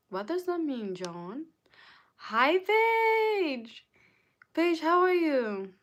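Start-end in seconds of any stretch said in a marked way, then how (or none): 2.2-5.7 high, loud, delighted hello; excited greeting. Real, not acted. Streamer greets viewer Paige, excited high call. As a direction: call out high to a friend just arriving.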